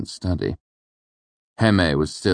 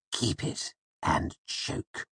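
low slow hissed whisper; 9 dB quieter, much slower than usual. A narrator's reading. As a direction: spit it out in a hiss. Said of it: "he hissed at me in a low voice".

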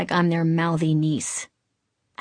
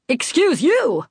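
higher, pointed comeback; indignant retort; 7 semitones higher, 3 dB louder, slower than usual. A narrator's reading. Man to woman he jostled at airport, indignant retort. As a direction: throw it back with acted indignation. Rude man snaps back.

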